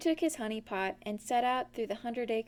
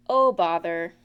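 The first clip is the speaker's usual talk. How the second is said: louder, lower fed-up sigh; exasperated Pooh; 4 semitones lower, 7 dB louder than usual. A narrator's reading. Pooh hitting another root, exasperated. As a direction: let the frustration push out. Pooh's frustrated catchphrase again.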